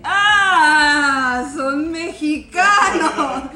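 loud, drawn-out discovery with a laugh; excited. Retold or imitated. Carla realizing the rude guys are Mexican, loud and gleeful. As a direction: shout it, delighted they speak Spanish. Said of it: "Y Carla dije".

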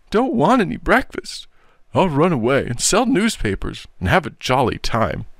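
put-on high-pitched childish wail of refusal; sulking boy. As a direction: refuse like a tearful, stubborn boy. Narrator acts the sulking, wailing boy.

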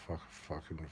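usual speaking voice

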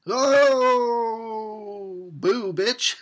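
high, loud taunting boo; playful scare shout; mock jump scare. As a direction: pop out with a cheeky boo. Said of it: "I wish he had said that".

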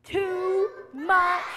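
high, loud, drawn-out cry; exasperated complaint. Acted. Man in story complains of noise, loudly exasperated. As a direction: cry it out for children to join. Man's complaint, children join in.

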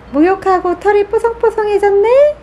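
high cooing to a pet. Real, not acted. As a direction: coo up high. Cooing to dog after bath.

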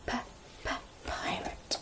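breathy unvoiced p, pirate; whispered p. Shown as a demonstration. Teacher to children, whispering the pirate's p. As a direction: puff the p out in a whisper.

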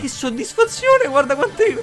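very high, slow gloating; squealing delight. Real, not acted. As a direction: squeal with satisfaction. Gleeful kill streak.

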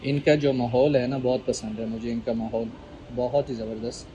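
chewing while talking; talking between bites; mouth full. Real, not acted. Seen 0: Spoons food into mouth at -2, then talks over it.

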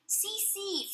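put-on high giggling toddler; laughing. A narrator's reading. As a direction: laugh it out at her. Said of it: "Fudge laughed".

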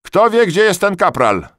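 very high, loud call across the room; loud question. A narrator's reading. Duty soldier asking the barracks loudly. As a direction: call out for the corporal.

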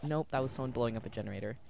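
ordinary delivery

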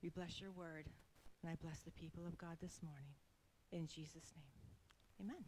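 soft, hushed closing prayer. Real, not acted. Woman gently closing her prayer to God. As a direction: pray it softly, almost whispered.

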